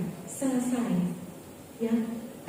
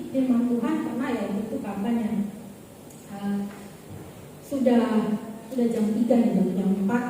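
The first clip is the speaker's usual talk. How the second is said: ordinary delivery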